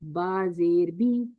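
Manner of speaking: slow drawn out बा जेर बी with a long बी; recitation of kasra. Shown as a demonstration. Teacher models बा जेर बी for students, slow and drawn. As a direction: stretch बी out slowly like a Quran reciter. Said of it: "आप पढ़ेंगे बा जबर बा".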